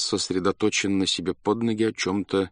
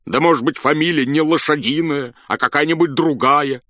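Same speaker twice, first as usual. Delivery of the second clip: pressing question; 7 semitones higher, 6 dB louder than usual. A narrator's reading. General pressing the clerk.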